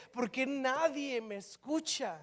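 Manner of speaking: mildly acted exasperation; frustrated. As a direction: ask it slowly, as a discouraged prophet. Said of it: "Jeremías se frustra porque dice".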